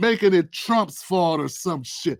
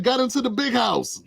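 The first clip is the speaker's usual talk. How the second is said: usual speaking voice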